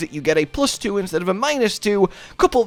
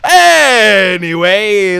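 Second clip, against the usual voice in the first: a big, stretched-out, higher call; loud drawn-out segue; 5 semitones higher, 10 dB louder, much slower than usual. Real, not acted. Host returning to the setup after joking, loud drawn-out segue. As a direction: belt it out slowly to get back on topic. Breaking off the laugh to resume.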